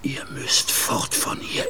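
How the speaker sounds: hushed and secretive, almost breathless; a frightened officer's whispered warning; urgent whisper. Acted. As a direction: whisper it so no one overhears.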